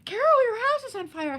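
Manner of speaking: high, loud mock alarm; playful high-pitched shout at a calm friend. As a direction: yell the warning in a hammed-up panic. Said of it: "and I'll go".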